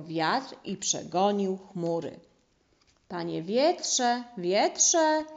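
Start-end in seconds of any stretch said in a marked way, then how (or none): 3.1-5.2 slow, loud calling call; shout. A narrator's reading. Father mouse calling out to the wind. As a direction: call the wind across the mountain. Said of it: "krzyknął tata mysz".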